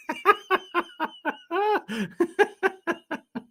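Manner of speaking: long falling laugh; real giggle trailing down; high laugh. Real, not acted. Laughing at own accent.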